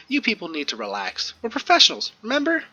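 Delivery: high, teasing pep talk; mocking team leader. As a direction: chide them with a grin. Monica chides team, voiced by GM.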